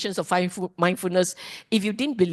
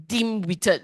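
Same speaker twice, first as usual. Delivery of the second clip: ordinary talk, nothing marked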